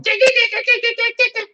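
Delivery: very high repeated syllables; piping dick dick dick in different tones; imitating stroke patient. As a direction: mimic her one word in a high sing-song. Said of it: "going up and down the hall going".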